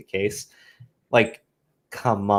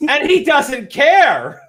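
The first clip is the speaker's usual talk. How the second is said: much higher, slow, outraged whine; mock scandalised critic; 5 dB louder, slower than usual. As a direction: parody gotcha accusers. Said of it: "they would sit there and go like".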